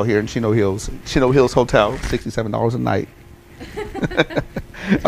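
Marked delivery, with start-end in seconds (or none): 3.6-5.0 a burst of high-pitched laughter. Real, not acted. Recalling the sixty-seven dollar hotel, a real high laugh.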